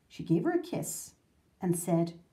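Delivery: plain reading voice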